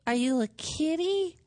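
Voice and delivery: cutesy voice for talking to animals; pet-talk voice. Retold or imitated. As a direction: coo at the stray cat like it's a puppy. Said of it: "my voice is annoying talking to dogs".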